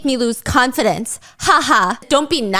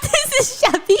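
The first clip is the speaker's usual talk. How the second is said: high, laughing through it; giggling too hard to talk; 12 semitones higher than usual. Real, not acted. Laughing at meme.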